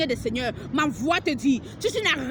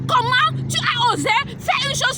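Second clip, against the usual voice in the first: shouted rebuke; 8 semitones higher than usual. Real, not acted. Prophetess shouting at the unfaithful pastor's wife. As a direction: cry out in outrage at her.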